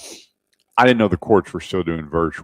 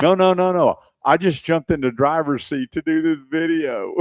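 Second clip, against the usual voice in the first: mock backpedalling; 8 semitones higher than usual. Retold driver backpedalling to judge.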